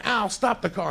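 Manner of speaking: high-pitched mock yell; retold panicked yell. Woman yelling at Al to stop driving, high imitated yell. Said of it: "and she's yelling".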